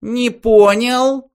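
high, loud, slow threat; menacing. A narrator's reading. Benji menacingly cutting off the toast. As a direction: lean in on it with menace. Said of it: "грозно сказал Бенджи".

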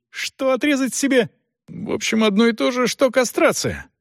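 high-pitched, indignant objection; agitated protest. A narrator's reading. Son protests shaving his moustache.

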